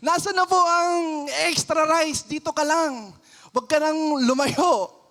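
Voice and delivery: high, loud demand for the soup; impatient customer. Acted. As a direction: act a fed-up diner yelling for food. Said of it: "pero kunyari matagal".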